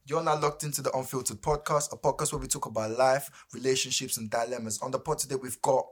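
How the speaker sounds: relaxed host introducing the show; podcast host intro. Acted.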